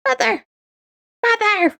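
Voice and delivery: put-on high nagging child voice; whiny kid calling mother. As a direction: pester mom over and over. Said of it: "you won't be like".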